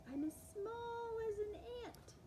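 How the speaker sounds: ordinary delivery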